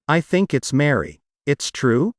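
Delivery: usual speaking voice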